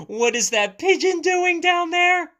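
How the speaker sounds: high, loud astonished exclamation; surprised. Acted. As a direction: gasp it out amazed. Surprised discovery in book.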